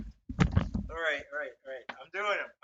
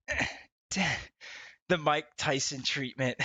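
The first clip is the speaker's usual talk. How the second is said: ordinary delivery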